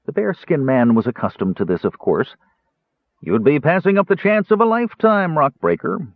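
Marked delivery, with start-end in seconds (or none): 3.2-6.1 higher, slick salesman voice; smooth dealer. Acted. Bearskin dealer coaxing the dwarf, smooth and slick. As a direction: sell it smoothly. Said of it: "he said smoothly".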